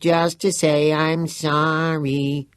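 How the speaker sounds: high, slow hammed-up falsetto croon; mocking falsetto. A narrator's reading. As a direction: sing it like a cheesy pop ballad. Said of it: "Antony falsetto".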